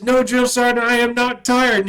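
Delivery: loud, high barked answer to the sergeant; shouted recruit reply. Retold or imitated. Recruit shouting back at his drill sergeant. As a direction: yell it out at attention.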